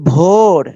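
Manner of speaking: ভোর with a long held "o"; long vowel. Shown as a demonstration. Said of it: "ও এর উচ্চারণ দীর্ঘ হয়".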